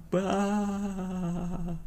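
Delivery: high, long drawn-out sheep bleat; helpless sheep cry. Retold or imitated. Reviewer mimics the sheep going over a cliff, helpless bleat. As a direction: imitate a sheep bleating helplessly on one held high note. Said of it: "it cries out, like, helplessly".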